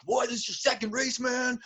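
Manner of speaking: high-pitched mock disbelief; imitated amazed racer; incredulous. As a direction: exclaim like an astonished rival.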